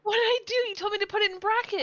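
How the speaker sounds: high, fast defensive outburst; loud squabbling self-defence; indignant protest. Real, not acted. Defensive bickering.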